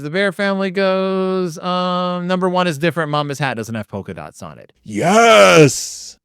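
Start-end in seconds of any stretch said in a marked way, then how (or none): 4.8-6.2 loud burst of victory; real excited shout; triumphant yes. Real, not acted. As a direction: cheer for your own win. Finishing the puzzle in record time.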